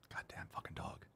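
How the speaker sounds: very soft, whispered curse; muttered grumble. Real, not acted. Owner cursing the useless dog, barely audible mutter. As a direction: grumble under your breath.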